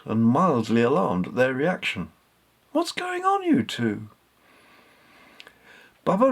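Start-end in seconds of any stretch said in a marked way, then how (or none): 2.7-4.1 a lightly voiced young woman, higher and puzzled; Katy, puzzled. Katy asking Bubba and Richard what is happening, lightly alarmed. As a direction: ask it with a high, mildly alarmed lift.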